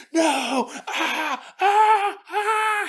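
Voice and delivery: high mock screams of terror; screaming. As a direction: scream as if seized in the dark. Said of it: "they hear him screaming".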